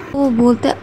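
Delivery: usual speaking voice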